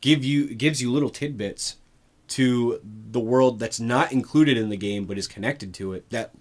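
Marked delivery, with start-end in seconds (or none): none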